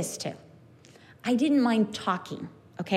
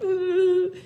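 tearful moan; 8 semitones higher than usual. Retold or imitated. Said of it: "And I'm like".

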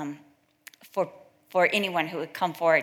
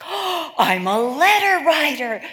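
bursting out loud and high on Oh; thrilled realisation, much louder; excited; 6 semitones higher, 10 dB louder, slower than usual. Retold or imitated. Retelling a prayer girl's discovery, excited loud outburst. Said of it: "She got so excited".

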